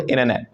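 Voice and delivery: "इंटरनेट" said as inner-net; dropped t. Shown as a demonstration. Teacher gives American example, "इंटरनेट" said as inner-net. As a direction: drop the first t of "इंटरनेट". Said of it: "टी समझ में आ रहा है".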